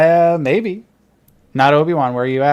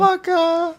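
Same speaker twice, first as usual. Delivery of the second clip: high catchphrase squeal. Retold or imitated. Repeating the catchphrase.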